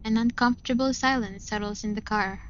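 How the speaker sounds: plain reading voice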